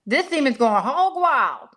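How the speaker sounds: high, slow, amazed quote; excited vet. Retold or imitated. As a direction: imitate a stunned vet, drawn out. Quoting vet on the phone.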